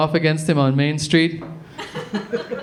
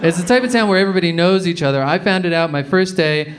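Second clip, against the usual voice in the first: normal talking voice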